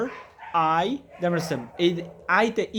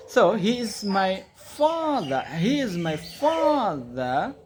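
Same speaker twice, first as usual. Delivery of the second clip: very slow, each word laid out clearly; slow teacher model; 3 semitones higher, much slower than usual. Shown as a demonstration. Teacher models a sentence for the student, very slow and clear. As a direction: model it slowly for the student to copy. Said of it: "slow pronunciation model for learners".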